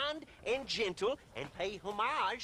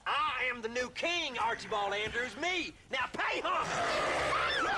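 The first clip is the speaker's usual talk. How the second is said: rising, then a slow, drawn-out shout on the last words; pompous royal command; slower than usual. Acted. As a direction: demand homage like a tin-pot king. Self-proclaimed king demands homage.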